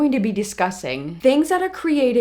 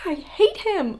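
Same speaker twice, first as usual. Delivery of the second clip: pitched much higher, fed-up hatred; real exasperation; 8 semitones higher, slower than usual. Real, not acted. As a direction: spit out the hate for the character. Raging at a book character.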